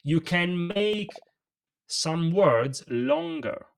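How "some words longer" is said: longer drawn out slowly; stretched word. Shown as a demonstration. Coach shows the audience how to lengthen a word. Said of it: "Now I'm making the word longer".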